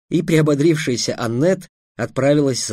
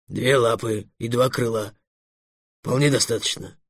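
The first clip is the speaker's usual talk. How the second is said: sad dragon; 3 semitones lower, 4 dB quieter than usual. A narrator's reading. Dragon defending his two paws, sadly. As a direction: say it softly, a touch of sadness. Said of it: "грустно сказал Эллен".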